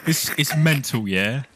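lads' banter in a fake Liverpool accent; cheeky roadman Scouser, lightly put on; put-on Scouse accent. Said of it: "props to you, [name]. That was good".

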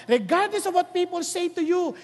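ordinary talk, nothing marked

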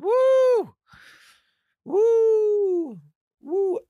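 high whooping cheers, fading; celebratory whoops. Real, not acted. Weights finally arrived, high whooping cheers fading out.

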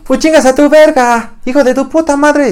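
put-on high, loud, slow bratty kid scream; mock kid shouting curses; kid yelling insults. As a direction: shriek it like a foul-mouthed barrio kid. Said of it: "el niño empieza a gritar".

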